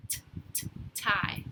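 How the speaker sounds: whispered t, t before tie; teacher sounding out a letter. Shown as a demonstration. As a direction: isolate the t sound softly. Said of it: "What do you think tie starts with?".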